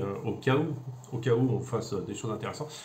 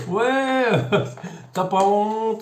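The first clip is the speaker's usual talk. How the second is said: high, loud indignant troll voice; mocking commenter; 9 semitones higher, 6 dB louder, much slower than usual. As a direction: squeal like an outraged commenter. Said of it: "les commentaires ... du genre". (internaute indigné, aigu et fort)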